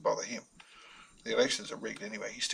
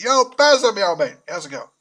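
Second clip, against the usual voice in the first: loud Australian shout; Aussie accent; 7 semitones higher, 14 dB louder than usual. Acted. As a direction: bellow it in a broad Australian accent. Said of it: "burst out in his Aussie accent".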